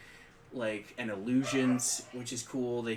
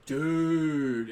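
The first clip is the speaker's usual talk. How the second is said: put-on laid-back California surfer accent; drawn-out surfer dude in California accent; 4 semitones higher, 7 dB louder than usual. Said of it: "talked like a Californian surfer".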